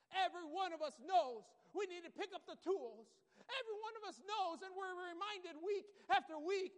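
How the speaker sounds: loud, building fervent sermon; preaching. Acted. Preaching builds to full force.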